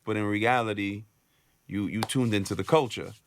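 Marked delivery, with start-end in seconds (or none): none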